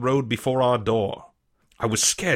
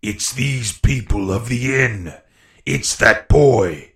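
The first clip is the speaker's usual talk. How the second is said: slow, loud villain's outcry; angry cry; 6 dB louder, slower than usual. A narrator's reading. Blind pirate blaming the boy, slow bitter cry. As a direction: cry it out slowly, building in volume. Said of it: "cried the blind man Pew".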